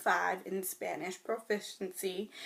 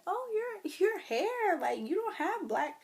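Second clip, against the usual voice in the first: nosy person; 12 semitones higher, 4 dB louder than usual. Retold or imitated. People commenting on her hair.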